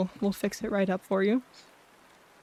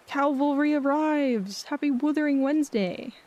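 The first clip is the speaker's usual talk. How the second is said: high bright welcoming streamer voice; cute anime streamer; 5 semitones higher than usual. Acted. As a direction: greet the viewers sweetly, up high. Greeting raid viewers in streamer voice.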